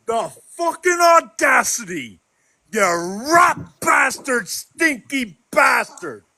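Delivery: real rage, slow and drawn out; furious yell. Real, not acted. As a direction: spit each word out in real fury. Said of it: "I've ever heard Irish that mad".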